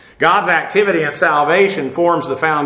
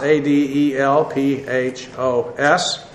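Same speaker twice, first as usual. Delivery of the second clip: slow spelling; 3 semitones lower, 3 dB quieter, slower than usual. Shown as a demonstration. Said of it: "the vocative of Adelphos".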